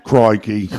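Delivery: quick Aussie Crikey; Australian accent. Shown as a demonstration. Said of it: "I can also do Aus-, Australian".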